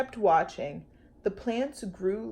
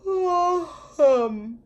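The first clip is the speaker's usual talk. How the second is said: yawn; 7 semitones higher, 4 dB louder than usual. Acted. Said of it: "And yawned".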